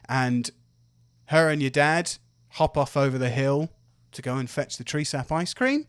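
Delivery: ordinary talk, nothing marked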